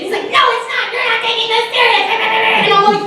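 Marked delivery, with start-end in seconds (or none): 0.3-2.1 high, rapid-fire mock indignation; mock frantic protest. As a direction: imitate a worked-up friend, high and hurried. Retold frantic friend arguing back.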